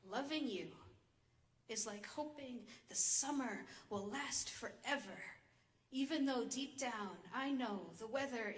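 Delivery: measured spoken-word delivery with rolling, rise-and-fall tune; poem recitation. Acted. Poet reads her poem on abusive love to an audience, measured recitation. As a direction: recite it in deliberate phrases with pauses.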